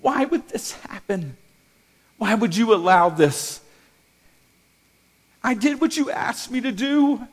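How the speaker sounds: high, loud cry of why; desperate prayer cried out to God; anguished plea. Retold or imitated. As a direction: plead with God, voice straining high. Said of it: "I was like".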